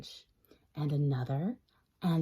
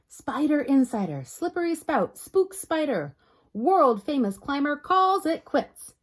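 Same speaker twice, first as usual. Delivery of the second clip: headline announcer; 6 semitones higher, 4 dB louder than usual. Acted. Reads tabloid headline.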